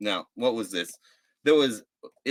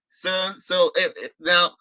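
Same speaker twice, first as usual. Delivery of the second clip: ordinary delivery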